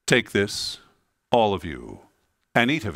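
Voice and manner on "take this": normal talking voice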